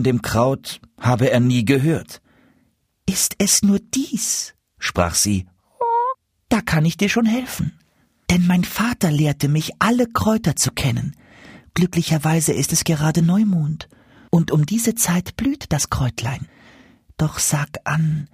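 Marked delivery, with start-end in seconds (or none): 3.1-16.5 much higher, kindly goose voice; goose Mimi. A narrator's reading. Goose Mimi calms the despairing dwarf, high and kind. 17.2-18.3 slow secretive whisper. A narrator's reading. Goose whispers her question to the dwarf.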